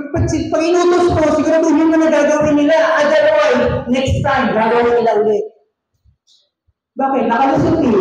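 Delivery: ordinary delivery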